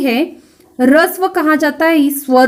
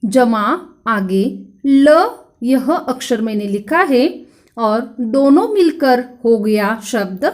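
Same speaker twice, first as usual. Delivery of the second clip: usual speaking voice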